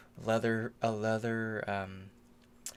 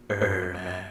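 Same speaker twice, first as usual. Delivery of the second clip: deeper, rumbling demon voice; Ermac voice; 5 semitones lower than usual. Pretend. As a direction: drop low like a haunted ninja. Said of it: "I'm trying to do the Ermac voice".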